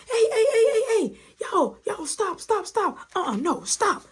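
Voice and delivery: high, urgent mock mother calling a halt; rapid repeated cries to break up a fight; alarmed mother. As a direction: imitate a mama shrieking at kids.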